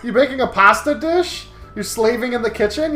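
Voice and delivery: ordinary talk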